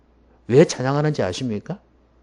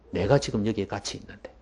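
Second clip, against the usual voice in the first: low, softer acted reproach; understated, lowered-voice chiding; quiet rebuke; 4 semitones lower, 5 dB quieter than usual. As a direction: say it low and restrained, as a gentle reproof. Jesus quote, first quiet take.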